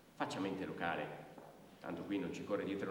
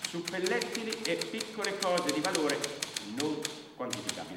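higher and louder clerk's dictation; official dictation; 9 semitones higher, 7 dB louder than usual. Acted. Brigadier listing the woman's losses as household goods. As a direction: declaim it as inventory.

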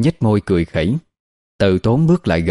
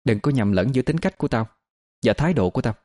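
higher, softer, coolly cutting reply; understated cold defiance; cold retort; 4 semitones higher, 5 dB quieter, faster than usual. A narrator's reading. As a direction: answer back with icy restraint. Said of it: "lạnh lùng đáp".

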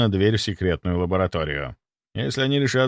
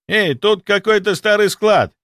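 raised, high-pitched call; 10 semitones higher, 6 dB louder than usual. A narrator's reading. Inquisitor calling to colleagues from a back nook, shouting. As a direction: shout it over from round a corner. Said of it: "крикнул второй инквизитор из-за кутка".

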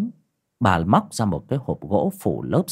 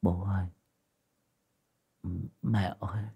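faint, much softer pleading; feeble whisper; 10 dB quieter than usual. A narrator's reading. Sick boy faintly calling his parents, weak and soft. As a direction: barely breathe the words out. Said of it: "nó mới thều thào như van xin".

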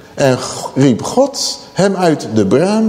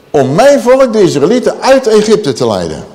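higher and louder proclamation; solemn raised command; God's command; 6 semitones higher, 4 dB louder than usual. Acted. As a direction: declare it as God's purpose. God commands Moses.

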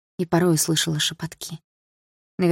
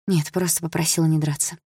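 plain reading voice

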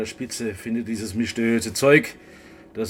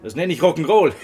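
louder, laughing through the words; 3 semitones higher, 6 dB louder than usual. Acted. Scientist teasing the two about eating it, laughing. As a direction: crack up as you say it.

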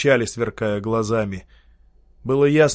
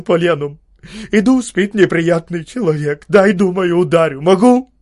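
higher, louder, voice near breaking; a ruined man rants in anguish; strained, broken; 6 semitones higher, 7 dB louder than usual. Acted. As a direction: force out a torn confession. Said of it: "надорванно говорил".

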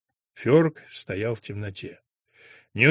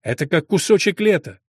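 louder, higher narrated enthusiasm; excited praise of a treat, raised voice; delighted exclamation; 5 semitones higher, 5 dB louder than usual. A narrator's reading. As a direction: lift it up in happy amazement. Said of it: "воскликнула Бар".

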